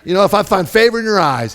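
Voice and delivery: high, loud mock-desperate demand; hammed-up fed-up outburst; smart-aleck Moses. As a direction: dare God with theatrical exasperation. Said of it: "He's just being a real smart aleck".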